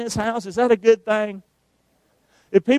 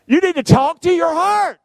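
preaching shout; 10 semitones higher than usual. Real, not acted. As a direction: belt it out to the whole church. Preacher exhorts the congregation.

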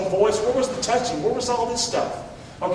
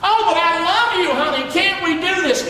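high, whiny mock plea rising to a shout; preacher mocking a boy begging his girlfriend, whiny; pleading boyfriend; 7 semitones higher than usual. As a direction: wheedle like a pushy boyfriend. Young man pressuring girl quoted.

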